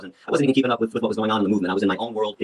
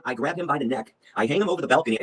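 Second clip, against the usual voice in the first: ordinary talk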